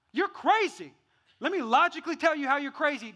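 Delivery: loud, high-pitched mock exasperation; exasperated arguing. Arguer trying logic on a crazy person, loudly exasperated. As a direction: hammer it at them as if arguing.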